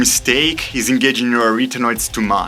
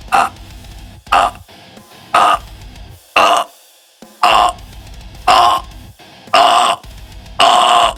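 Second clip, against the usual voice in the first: compressed growl, building; 3 semitones lower, slower than usual. Shown as a demonstration. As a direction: push more air each time. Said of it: "pushing a bit more air each time".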